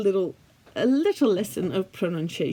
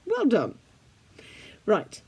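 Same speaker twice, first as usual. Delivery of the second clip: normal talking voice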